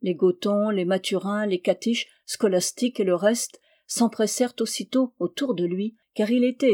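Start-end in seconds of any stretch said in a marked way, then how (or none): none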